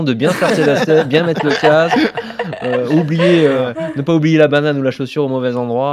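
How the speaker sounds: conseils donnés en pouffant de rire; en riant. Real, not acted. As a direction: parle à travers le rire, plus fort. Conseils aux amateurs en riant. (voix rieuse et forte)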